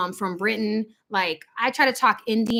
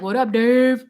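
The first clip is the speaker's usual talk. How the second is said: louder, playful gangster-style greeting; lightly put-on gangster voice; mock gangster; 5 dB louder than usual. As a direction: hail a buddy in mock street slang.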